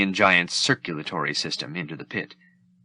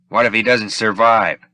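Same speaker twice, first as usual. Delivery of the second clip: calculating; 7 dB louder than usual. Acted. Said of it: "the calculating Boba Fett asked".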